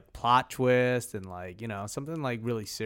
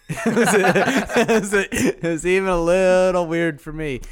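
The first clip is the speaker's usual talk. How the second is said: laughing through the words, louder; 3 semitones higher, 6 dB louder than usual. Real, not acted. Reacting to the fan fiction, laughing loudly. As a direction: giggle out the words.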